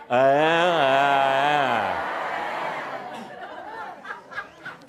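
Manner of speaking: tongue stuck out, drawn out. Real, not acted. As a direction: say it with your tongue poking out. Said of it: "Stick your tongue out and say".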